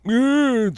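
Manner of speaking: high, loud mock moan of pleasure; hammed-up bedroom groan; sex moan. Host mocking a couple's loud moaning, hammed-up moan. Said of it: "You'd be like".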